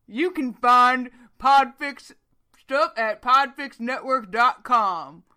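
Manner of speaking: loud, slow, put-on manly announcer voice; Manly Stanley. Host plugging the podcast network for Wayne, mock manly voice. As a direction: draw out the plug in a hammed-up manly voice. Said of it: "I'll do it in my manly Stanley voice for Wayne".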